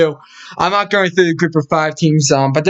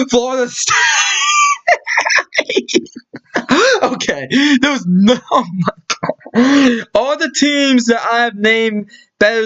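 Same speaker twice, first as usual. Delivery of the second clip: laughing fit; 8 semitones higher than usual. Real, not acted. Cracks up at the odds.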